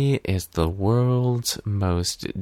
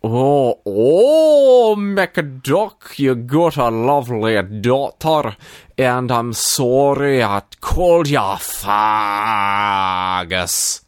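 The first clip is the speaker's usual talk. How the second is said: put-on Scottish accent; 2 semitones higher, 4 dB louder, slower than usual. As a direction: put on a broad, exaggerated Scottish brogue. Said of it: "say sorry in your best Scottish accent".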